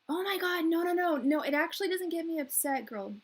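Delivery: loud, high, urgent protest; emphatic reassurance. Real, not acted. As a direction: rush to reassure a friend, raising your voice. Hastily reassuring a worried viewer.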